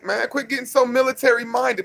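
normal talking voice

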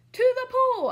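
high, loud yell; boy yelling along, high and loud. Acted. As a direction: shout it back eagerly. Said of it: "I yelled".

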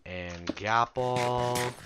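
usual speaking voice

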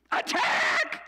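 high, breathy yelled charge; mock war cry; battle cry. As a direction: scream the charge to the troops.

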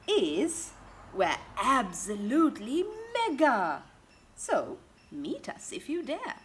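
lively, sing-song picture-book reading; storybook read-aloud voice; reading to children. Acted. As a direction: read it out big and bouncy for little ones.

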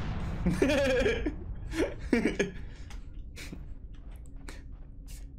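high breathy laughing fit; real laugh at his own joke; long laugh. Real, not acted. Gamer laughing at his own joke, high and breathy.

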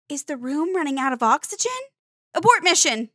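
put-on a kid's thoughts spiraling into alarm; panicky. Child panicking in the dark.

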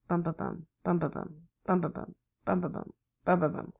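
"bum, bum, bum" in the Tennessee rhythm, ten-ne-SEE; soft rhythmic bums, last beat strongest; S4 gallop beat. Shown as a demonstration. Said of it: "That's a little bit different than Kentucky".

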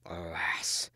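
hushed, voiceless sigh of grief; whispered lament. A narrator's reading. Gawain as the queen's hour comes, whispered lament. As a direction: breathe it out in a whisper. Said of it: "said Sir Gawain".

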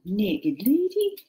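slow, drawn-out mock Welsh accent. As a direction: put on a slow Welsh lilt. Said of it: "better than in a Welsh accent".

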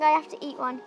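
normal talking voice

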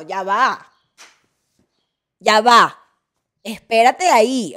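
loud, slow stop-right-there; loud exasperated "wait, hold on"; mock outrage. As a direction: shout them down to halt them. Ranting at spoiler-averse people.

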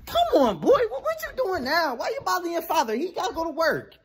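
mom voice. Acted. Mom voice scolding boy.

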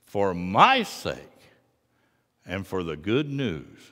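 ordinary talk

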